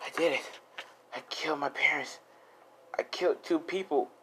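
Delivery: hushed, winded confession; soft and out of breath after the fight; breathing heavily. Acted. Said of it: "as he's breathing heavily".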